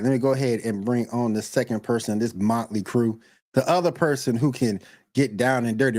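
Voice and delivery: ordinary delivery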